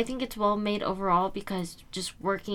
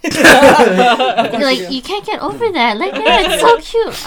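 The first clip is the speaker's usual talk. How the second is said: laughing gush; 5 semitones higher, 12 dB louder, slower than usual. Real, not acted. Gushing about the truffle shuffle.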